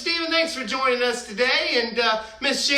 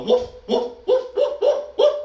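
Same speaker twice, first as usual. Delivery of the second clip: barking climbing higher and louder; dog barks; 12 semitones higher, 4 dB louder than usual. Acted. Leader barking for the kids, rising higher and louder. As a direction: bark like an excited dog. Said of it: "Can you make the sound of a dog?".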